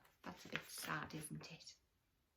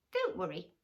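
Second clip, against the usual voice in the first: higher, reassuring mouse; lightly voiced mouse; 10 semitones higher, 5 dB louder than usual. The mouse reassures the crocodile, lightly voiced. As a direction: promise it kindly. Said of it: "answered the mouse".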